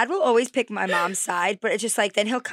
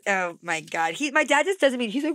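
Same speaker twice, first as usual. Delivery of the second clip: normal talking voice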